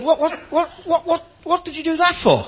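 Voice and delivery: high, stammering protest; indignant leopard. Acted. Leopard questions the lion who ate the fiddler, indignant. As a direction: pitch it up high as a baffled leopard.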